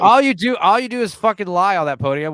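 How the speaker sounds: loud, high accusing rant; mock angry rant. Friends mocking the press secretary, shouted accusation. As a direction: yell accusations at the podium.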